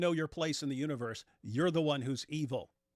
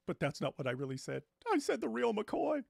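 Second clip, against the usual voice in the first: quoting the film narrator; 7 semitones higher than usual. Retold or imitated. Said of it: "But then the narrator goes".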